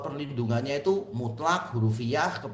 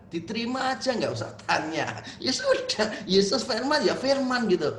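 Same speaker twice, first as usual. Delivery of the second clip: mocking imitation; 5 semitones higher than usual. Mocking the congregant's literalism.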